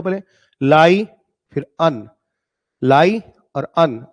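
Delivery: lion broken into लाई and अन; syllable by syllable, लाई then अन; split syllables. Shown as a demonstration. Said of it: "दो part में पढ़ो".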